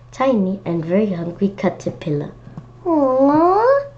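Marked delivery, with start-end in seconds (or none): 2.9-3.9 aww. Real, not acted. Cute baby caterpillar in book.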